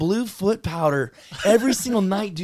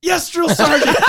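high, loud recruit reply; yes sir military replies; 11 semitones higher, 6 dB louder than usual. Retold or imitated. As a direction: snap it out like a recruit. Recruit answering sergeant.

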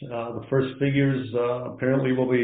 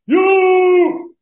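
long, high, loud sustained holler; imitating a drawn-out ring yell, pitched way up; imitated wrestler yell; 11 dB louder than usual. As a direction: belt it out like a wrestler's entrance yell. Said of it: "and then would yell".

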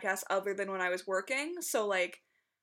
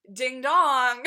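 loud, bright Ding dong greeting; podcast catchphrase; 3 semitones higher, 11 dB louder than usual. Retold or imitated. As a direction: sing out Ding dong like the hosts. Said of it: "they always say".

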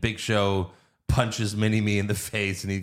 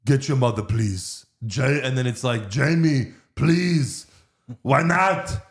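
a hint of Austrian accent; Arnold impression. Podcaster quotes Arnold's phone lines in a light Austrian accent. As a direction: read it like a prank-call clip. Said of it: "'cause he's like".

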